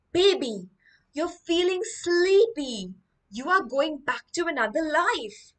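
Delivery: put-on hypnotist putting baby under. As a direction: draw it out to lull a baby into trance. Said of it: "So now he starts hypnotizing the baby".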